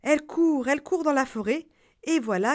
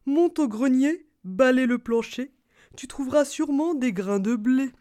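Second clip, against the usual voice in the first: put-on bossy old man; 5 semitones higher, 5 dB louder than usual. Old man giving orders.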